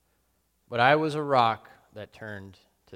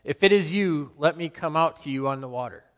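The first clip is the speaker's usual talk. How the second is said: a loud, higher call across the water; cried out; 4 semitones higher, 7 dB louder than usual. Retold or imitated. Peter calling out to Jesus on the water, loud and eager. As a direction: call out to him boldly over the waves. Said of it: "I cried out".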